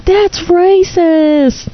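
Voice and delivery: slow mock accusation. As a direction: stretch it out in a high mock voice. Drawn-out joke reply.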